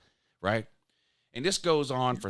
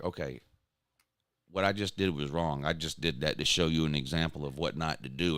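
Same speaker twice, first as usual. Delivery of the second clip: dad mode; 9 semitones lower than usual. Retold or imitated. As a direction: talk down to the daughter like a calm dad. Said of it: "immediately went into dad mode".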